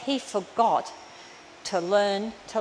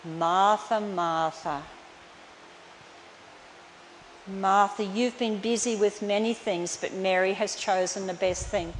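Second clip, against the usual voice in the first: softer, lower, gentle correction; tender; 3 semitones lower, 5 dB quieter than usual. Retold or imitated. Jesus gently correcting busy Martha, soft and tender. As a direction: call her name gently, like a soothing friend. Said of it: "so tender, so graceful".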